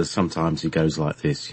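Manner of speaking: light Cockney lilt, low and soft; a little Cockney. Acted. Showing how a character talks, a little Cockney. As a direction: slip into a hint of London Cockney. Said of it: "Rodan is a little Cockney".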